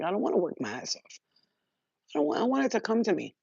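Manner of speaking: fast whiny quote. Retold or imitated. Lazy inner voice quoted.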